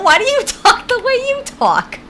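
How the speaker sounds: rising to a very high, loud squeal; mock exasperation. Streamer throws the question back at chat, mock-exasperated squeal. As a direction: climb into a loud, high-pitched mock protest.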